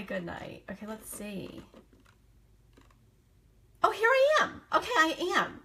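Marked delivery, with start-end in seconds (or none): 3.8-5.6 high, louder oh of relief; relieved surprise. Real, not acted. Host finding her stream, high relieved exclaim. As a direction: burst out, relieved to find yourself. Finds herself live at last.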